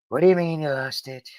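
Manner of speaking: lightly voiced president impression; Trump. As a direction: question the secretary as a mock boss. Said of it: "go to Trump and be like".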